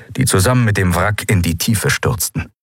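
ordinary delivery

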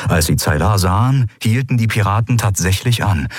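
ordinary delivery

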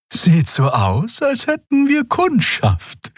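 very high, slow character voice; musing character. A narrator's reading. Crew member seeing lights on the ship, slow musing. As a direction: draw out the observation high and slowly. Xea's voice, spotting lit windows.

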